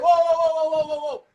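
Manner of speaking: loud hammed-up whoa, whoa, whoa; shouted whoa. Shown as a demonstration. Husband demonstrating to wife how to shut her dad down, shouting whoa. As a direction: bark out a string of whoas to interrupt. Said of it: "You supposed to be like".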